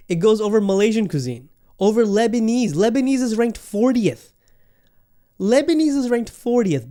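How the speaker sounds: indignant. Real, not acted. Outraged ranking rant.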